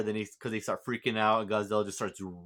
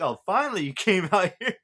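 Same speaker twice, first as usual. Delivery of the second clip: high, loud, laughing quote; 8 semitones higher, 7 dB louder than usual. Real, not acted. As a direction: crack up mid-line. Laughing while quoting Koichi.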